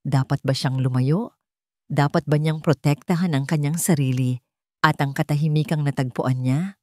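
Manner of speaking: ordinary delivery, nothing marked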